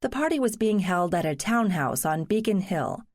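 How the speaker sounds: plain reading voice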